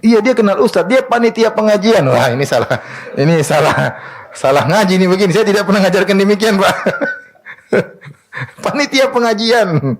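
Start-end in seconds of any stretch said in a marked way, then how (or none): 0.0-2.0 proud policeman. Retold or imitated. Preacher voices policeman's proud reply. 7.7-9.9 real laugh through the words, higher; laughing in disbelief at the irony. Real, not acted. Preacher laughing to the women at the policeman's naive boast.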